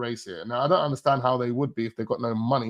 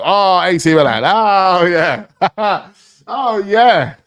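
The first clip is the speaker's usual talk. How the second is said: louder, higher, laughing "oh yeah"; gleeful realization; 6 semitones higher, 11 dB louder, much slower than usual. Real, not acted. Vlogger sees Morata joined A C Milan, gleeful laughing. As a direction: laugh it out like you just caught them.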